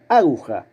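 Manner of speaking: "aguja" dicho Á-guja, con la A tónica; acento en la primera. Shown as a demonstration. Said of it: "si la sílaba tónica fuera la primera". (águja, esdrújula, acento inicial)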